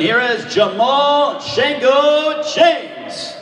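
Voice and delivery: ring announcer. Real, not acted. Ring announcer calling the fighter.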